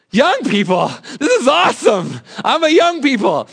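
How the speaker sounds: put-on overjoyed at being called young people; delighted. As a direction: shout it like the best compliment ever.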